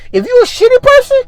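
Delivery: very high, strained pitch; mock warning. Real, not acted. Comic warning shitty person on that job, high-pitched. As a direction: climb high and stretch the ending.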